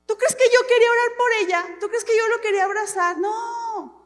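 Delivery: loud, high rhetorical protest; exasperated comic outburst; mock indignation. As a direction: protest as if the very idea is absurd. Preacher replays her indignant reluctance.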